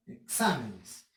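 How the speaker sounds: slow "exámenes", weight on "xá"; stress on XÁ. Shown as a demonstration. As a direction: lean on the second syllable of "exámenes". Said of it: "identificar la sílaba tónica".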